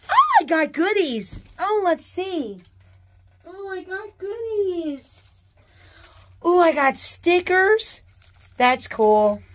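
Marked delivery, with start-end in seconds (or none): 0.1-8.0 excited squeal. Real, not acted. Unboxing goodies, high excited gushing. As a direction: gush over the freebies.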